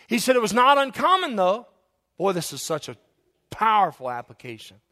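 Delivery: high, loud pulpit fervor; full-throated sermon climax; preaching at full force. Acted. Preaching builds.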